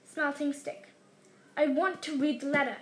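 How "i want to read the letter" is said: usual speaking voice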